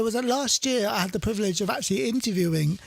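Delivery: usual speaking voice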